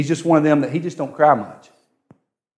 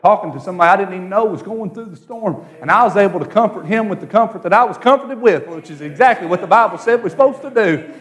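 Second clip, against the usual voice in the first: higher, rolling sermon delivery; fervent preaching; 7 semitones higher, 3 dB louder, faster than usual. Acted. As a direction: preach it with mounting fervour. Preaches with rising force.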